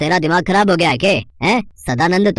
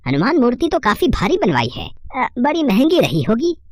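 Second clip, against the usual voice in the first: high-pitched, inquisitive brother-in-law; scheming brother-in-law; 8 semitones higher than usual. Acted. Brother-in-law sizing up the gold idol, high-pitched and probing.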